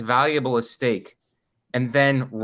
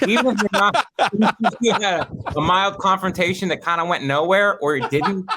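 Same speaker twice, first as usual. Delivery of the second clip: talking through a laugh; 5 semitones higher than usual. Real, not acted. Cohost teases the storyteller, laughing as he talks. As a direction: laugh while teasing about the story. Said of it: "That's great".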